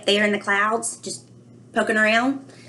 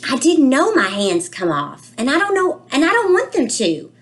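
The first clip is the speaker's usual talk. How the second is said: higher, louder, rising in fake alarm; mock alarm; 7 semitones higher, 4 dB louder than usual. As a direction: panic playfully about hands coming off. Child narrator panics over hands.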